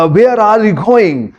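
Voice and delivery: slow, posh-English overdone line; hamming up an English accent in suit and boots; mock English style. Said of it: "style से खड़े हो जाए". (सूट boot पहनकर अंग्रेज़ी अंदाज़ की नकल)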